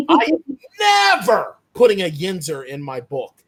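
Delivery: laugh, then hit NEVER hard; emphatic refusal. Real, not acted. Author vowing to keep Yinzers out of her book, emphatic.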